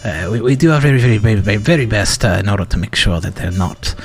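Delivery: a lightly done British trainer keeping everyone calm; calm British accent. Pretend. Trainer addressing the chat in a calm British accent. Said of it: "it has to be that calm one".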